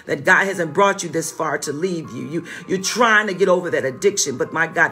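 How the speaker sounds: ordinary talk, nothing marked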